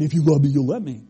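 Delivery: a hint of a smooth talker pressuring his girlfriend; manipulative boyfriend. Pastor mocks a boyfriend pressuring his girlfriend, lightly voiced and quick. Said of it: "it's just manipulation".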